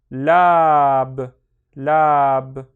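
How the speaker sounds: LAB loud and long, on its own; loud, drawn-out syllable demo of "lab"; isolated syllable. Shown as a demonstration. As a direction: sound out "lab" with a stretched vowel. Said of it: "read each one on its own".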